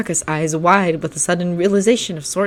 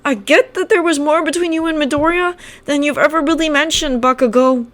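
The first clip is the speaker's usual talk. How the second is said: put-on higher, careful boy's voice; Kirishima voice; 11 semitones higher than usual. Kirishima carefully raising the past with Bakugo, higher. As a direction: try to reason gently. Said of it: "Kirishima continued".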